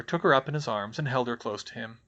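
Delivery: usual speaking voice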